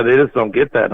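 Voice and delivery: ordinary talk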